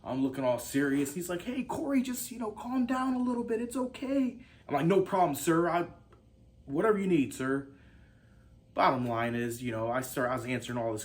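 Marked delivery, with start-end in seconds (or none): 1.4-4.4 much higher put-on boss voice; mock soothing interviewer. Acts out interviewer soothing him. 4.8-7.7 louder, higher, lightly soldierly reply; mock military deference. Acts himself standing at attention, answering.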